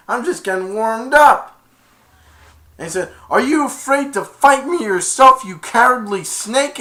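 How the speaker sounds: high, loud brave taunt; heroic challenge. Retold or imitated. Aladdin daring Jafar to fight, loud high defiance. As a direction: throw the challenge at the villain.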